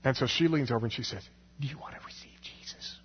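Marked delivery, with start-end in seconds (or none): 1.6-3.0 hushed unvoiced whisper in church. Churchwoman leaning over to Bob during altar call, whispering. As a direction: lean in and whisper the question.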